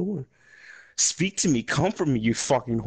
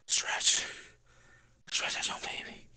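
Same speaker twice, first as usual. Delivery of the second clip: whispered, much softer coaxing; strained whisper; 9 dB quieter than usual. Real, not acted. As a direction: coax the muscle under your breath. Coaching cramped muscle.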